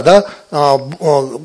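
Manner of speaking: ordinary delivery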